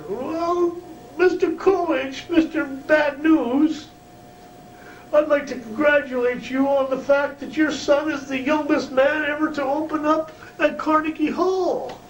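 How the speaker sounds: very high, earnest congratulating voice; Carl's voice. Retold or imitated. Carl congratulating Bad News on his son, very high. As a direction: pipe it up high and sincere.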